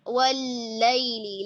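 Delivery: वल्लैलि with a full clear doubled L; lam articulated fully. Shown as a demonstration. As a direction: sound the लाम of वल्लैलि completely. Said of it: "लाम का मखरज complete". (वल्लैलि में लाम का पूरा मखरज)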